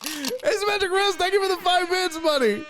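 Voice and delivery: high-pitched donor shout-out; hollered thanks. Pretend.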